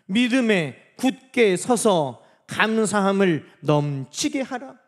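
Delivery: slow, loud, measured verse; deliberate declaimed reading; communal scripture reading. Real, not acted. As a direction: lead the congregation word by word. Leads congregation reading scripture.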